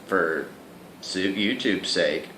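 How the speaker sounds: normal talking voice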